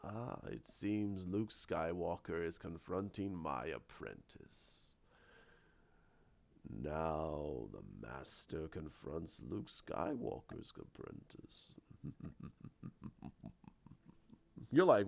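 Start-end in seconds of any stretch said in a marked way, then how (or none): 0.0-11.7 slow, very low, hushed villain; Snoke. Retold or imitated. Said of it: "you see Snoke's in there going". 12.8-13.5 very soft, slow sinister chuckle; hushed gloating laugh; villain chuckle. Retold or imitated. Snoke gloating at his foe.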